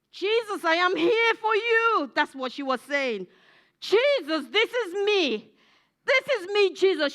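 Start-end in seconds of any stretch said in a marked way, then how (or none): 0.1-2.1 high, loud cry to Jesus; raised voice, offering oneself; fervent plea. Acted. Acting out woman at Jesus' feet. 3.8-7.1 high, raised cry for attention; fervent plea. Acted. Woman calling out to Jesus.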